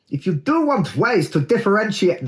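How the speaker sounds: a Russian accent creeping in unintended; slipped into Russian. Real, not acted. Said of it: "that, that went Russian".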